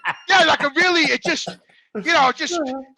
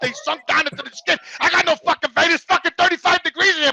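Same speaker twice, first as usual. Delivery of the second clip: heated, raised-voice grumbling; worked-up rant; 5 semitones higher than usual. Real, not acted. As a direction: rail about it, exasperated. Ranting about cold emergency rooms.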